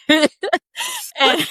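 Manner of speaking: high laughter breaking through, slow; laughing. Real, not acted. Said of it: "laughing at sweatpants".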